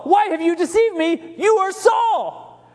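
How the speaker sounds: high, slow cry of alarm; the medium cries out at Saul, high and drawn out; loud cry. A narrator's reading. Medium unmasks the disguised king, crying out high and slow. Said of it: "she cried out in a loud voice".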